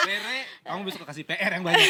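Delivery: joking through real laughter; words breaking into a laugh; laughing tease. Real, not acted. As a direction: tease the pupil while laughing. Joking amid laughter at story.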